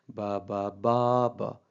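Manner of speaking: "बा" said four times with one बा held noticeably longer; one syllable lengthened. Shown as a demonstration. Teacher demonstrates syllable length for the students, one बा drawn out. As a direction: stretch out a single बा in the row. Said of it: "अब एक को मैंने lengthy किया".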